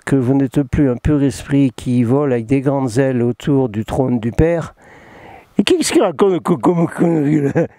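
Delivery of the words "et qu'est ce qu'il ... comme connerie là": mock a baffled viewer with a put-on voice; imagined heckler. Mimics a viewer doubting what he tells, mocking.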